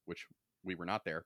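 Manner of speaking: ordinary talk, nothing marked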